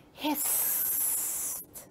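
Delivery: drawn-out, soft sss; long snake hiss. A narrator's reading. Snake facing the frog, long drawn-out hiss. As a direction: stretch the word into a long hiss.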